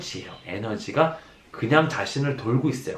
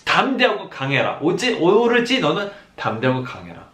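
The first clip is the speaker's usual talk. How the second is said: ordinary talk, nothing marked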